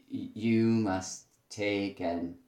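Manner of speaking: normal talking voice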